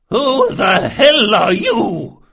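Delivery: high, drawn-out bellow at full force; outraged bellow. A narrator's reading. Old noblewoman confronting intruders in her solarium, bellowing. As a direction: bellow it slowly, voice pitched right up. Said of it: "she bellowed".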